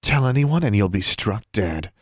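plain reading voice

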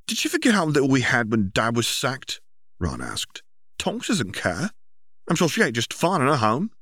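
lightly voiced angry brother; bitter argument. Brother argues bitterly with older brother, angry.

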